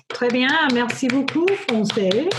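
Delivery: English speaker's French accent; a hopeful stab at a French accent; attempted French. Real, not acted. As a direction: try your best French accent. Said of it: "trying really hard to get my best French accent going".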